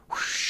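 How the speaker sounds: airy rushing whoosh; wind whoosh. A narrator's reading. Sloop slipping out with wind, whoosh. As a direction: let the breath rush like wind. Narrator imitates wind in sails.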